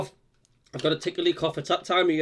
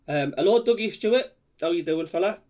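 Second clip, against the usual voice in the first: ordinary talk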